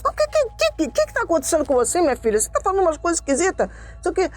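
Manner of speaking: high, stammering, flustered delivery; retold agitation, hurrying to end the call; imitating nervous father. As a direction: mimic a worried dad stumbling over his questions. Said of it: "Pai, não fica nervoso".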